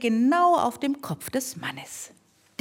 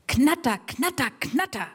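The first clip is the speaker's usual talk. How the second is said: mower noise; 5 semitones higher than usual. Acted. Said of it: "lawnmower noise imitated".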